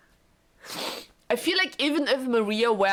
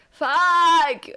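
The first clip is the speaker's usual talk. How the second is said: higher, louder drawn-out curse; anguished shout; 8 semitones higher, 7 dB louder than usual. Real, not acted. Streamer cursing at the ruined marriage, real shout. Streamer despair after more letters.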